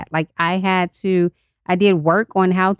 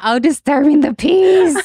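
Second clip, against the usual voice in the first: higher-pitched, laughing exclamation; laughing realization; 7 semitones higher, 4 dB louder than usual. Real, not acted. Co-host finally gets what D T P means, laughing realization. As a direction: let it click and laugh through the words.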